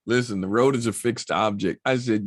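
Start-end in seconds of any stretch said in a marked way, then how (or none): none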